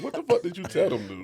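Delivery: high, laughing disbelief; half laughing accusation. Retold or imitated.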